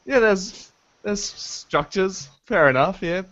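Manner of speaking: underplayed, indifferent shrug of a reaction; unimpressed. Imagining the crew's reaction to alien structures, unimpressed. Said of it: "it's more skepticism than anything".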